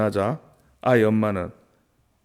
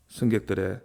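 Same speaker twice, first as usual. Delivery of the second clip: ordinary delivery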